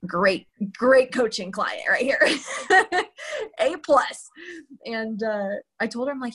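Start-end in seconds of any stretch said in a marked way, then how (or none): 2.3-3.6 a sudden squeal of laughing at herself; high burst of laughter. Real, not acted. To listener about being a poor coaching client, a high laugh. 3.6-4.3 said through a laugh, a bit higher. Real, not acted. Mocking her own coaching record to listener, laughing. Joking about herself as client.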